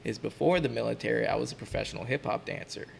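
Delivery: ordinary talk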